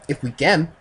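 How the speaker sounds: lightly put-on Japanese accent; attempted Japanese accent. Reader voices the Japanese man answering, Japanese accent. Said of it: "I can't do a Japanese accent".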